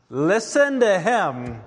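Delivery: listen to him, pressed out slow and strong; emphatic command. Acted. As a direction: proclaim it as God's final word. Climactic divine command.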